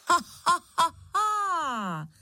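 cackle, then stretch the last note falling; howling witch laugh. A narrator's reading. Witch howling right beside the hero, cackling. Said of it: "Zaskučelo těsně vedle něho".

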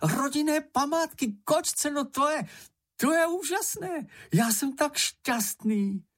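very high, thrilled character voice; high little voice, slowing on the final words; overjoyed exclamation. A narrator's reading. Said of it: "zvolal Čenich".

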